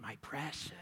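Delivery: breathy, hoarse whisper to the ring; Gollum-like whisper. Retold or imitated. Bilbo whispering over the ring he is stroking, creepy whisper. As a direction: whisper it like a creature cradling its treasure. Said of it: "he starts stroking it".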